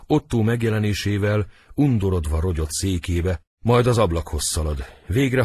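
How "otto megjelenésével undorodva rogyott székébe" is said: ordinary talk, nothing marked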